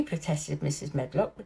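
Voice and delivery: usual speaking voice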